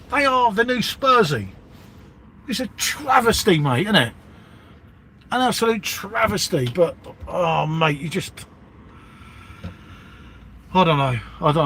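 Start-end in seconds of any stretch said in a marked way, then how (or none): 0.1-6.7 rising indignation at the rival club; worked-up rant. Real, not acted. Fan ranting to his co-host about Arsenal, worked-up and higher-pitched. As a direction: pitch jumps higher as he gets riled.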